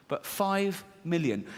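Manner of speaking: ordinary talk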